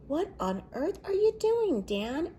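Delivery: baker asking. A narrator's reading. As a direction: demand an answer in disbelief. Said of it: "asked the baker".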